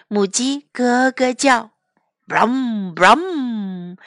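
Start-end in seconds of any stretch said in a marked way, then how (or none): 2.3-4.0 low engine rumble; tractor engine. Acted. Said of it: "went the tractor".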